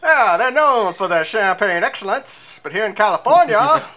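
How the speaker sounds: nasal mid-Atlantic announcer voice; old-time mid-Atlantic radio accent. Retold or imitated. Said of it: "my pronounced nasally mid-Atlantic accent".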